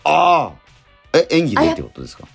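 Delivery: usual speaking voice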